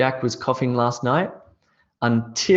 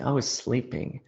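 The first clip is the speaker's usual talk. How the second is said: much softer, drowsy echo of "I was sleeping"; sleepy murmur; 8 dB quieter than usual. Shown as a demonstration. Teacher echoing the answer about last night, softly and sleepily. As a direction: let "I was sleeping" fade out like you're dozing off. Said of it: "what was I doing last night?".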